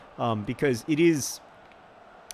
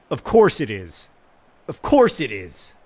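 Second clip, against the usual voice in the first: loud, bitter resignation; exasperated; 2 semitones lower, 9 dB louder than usual. Real, not acted. Gamer conceding a goal, loud exasperated disgust. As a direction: spit it out in disgust. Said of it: "I'm infuriated".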